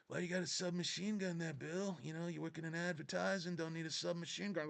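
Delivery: high, soft put-on character voice; movie hero impression. Questioning Bill about his submachine gun, soft put-on voice.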